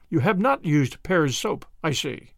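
plain reading voice

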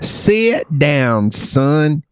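high, hammed-up country twang; exaggerated country drawl; country accent. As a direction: stretch the vowels like a country singer. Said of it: "said it with a country accent like this".

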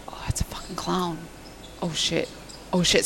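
terrified unvoiced whisper; whispering in fright at the clown; scared whisper. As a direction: whisper in dawning fear.

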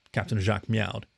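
usual speaking voice

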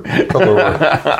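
put-on slow, stretched French-accented color with a laugh; mock French accent. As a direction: drawl it like a joking Frenchman. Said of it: "I'm doing a French accent".

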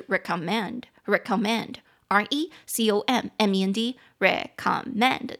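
slow spelled-out recommend, stress on the last syllable men; teaching pronunciation. Shown as a demonstration. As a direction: recommend said slowly, letter by letter, then stressed re-com-MEND. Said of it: "重音节在第三音节".

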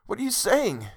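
high, impassioned pleading; worshipful lover begging to understand; anguished lover. A narrator's reading. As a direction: plead in bewildered sorrow, pitched well up. Man pleads, bewildered by her words.

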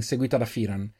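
ordinary delivery, nothing marked

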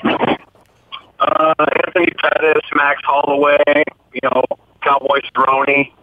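garbled, bubbly underwater phone line. Real, not acted. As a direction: voice gurgling like it's underwater. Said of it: "it sounds like you're underwater".

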